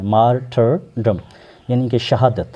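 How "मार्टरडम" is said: Martyrdom broken into mar, ter, dum; slow teaching model of martyrdom, each syllable separate. Shown as a demonstration. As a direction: spell out martyrdom slowly by syllables. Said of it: "जल्दी में".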